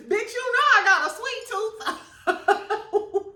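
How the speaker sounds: high, slow, laughing sass; high slow mock mama drawl; sassy mama. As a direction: own the sweet tooth, unbothered. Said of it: "Her mama was like".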